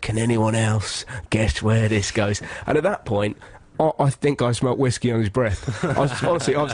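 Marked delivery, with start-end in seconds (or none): 0.0-2.4 slow, theatrical question; menacing torturer. Retold or imitated. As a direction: taunt the crowd in a drawn-out actor's voice. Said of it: "pulled out this stick with".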